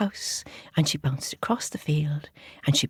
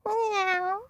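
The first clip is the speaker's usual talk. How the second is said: high drawn-out pussycat meow; cat meow; 14 semitones higher than usual. Acted. Storyteller playing a pussycat, high meow. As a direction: meow like a cat being called.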